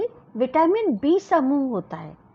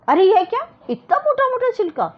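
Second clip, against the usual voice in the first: high, loud shocked scold on मोटा मोटा; scolding aunt; 11 semitones higher, 3 dB louder than usual. Acted. Aunt scolding the girl over thick peels. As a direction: scold the niece, stressing मोटा मोटा sharply. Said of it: "मौसी ने डांट दिया".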